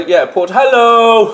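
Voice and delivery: high, slow suggestive hello; cheeky drawn-out hello. Pretend. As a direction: stretch it out with a wink. Mock-suggestive hello at cheese name.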